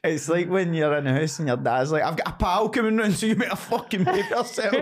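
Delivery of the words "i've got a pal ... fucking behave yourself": a hint of a warning father, higher; lightly voiced dad laying down the law; stern dad.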